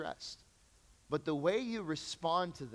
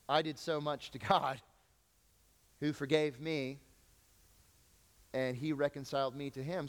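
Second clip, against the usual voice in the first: normal talking voice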